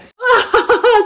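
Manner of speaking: éclat de rire aigu et fort; rire excité. Real, not acted. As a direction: rire d'impatience. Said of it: "Je suis excitée". (rire aigu, plus fort, tout excitée)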